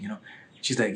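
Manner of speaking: usual speaking voice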